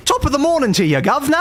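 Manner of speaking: high, bright mock British "Top of the morning"; British accent. Shown as a demonstration. Streamer asked to talk in British, hammy British accent. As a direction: put on a cheesy British accent. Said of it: "British things, but I'm not very good at, like, accents".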